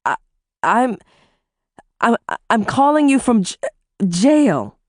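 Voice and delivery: halting, stuttering confession. A narrator's reading. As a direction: stumble over the words in fear. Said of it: "I stuttered".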